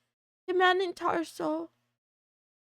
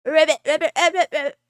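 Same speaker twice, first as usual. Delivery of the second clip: louder ribbits in a quick chain; playful ribbit chorus, louder; loud frog croak; 7 dB louder, faster than usual. Pretend.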